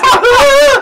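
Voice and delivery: very high, loud mock scream; child's wail. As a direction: wail like an upset child running off. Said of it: "Jeremy goes".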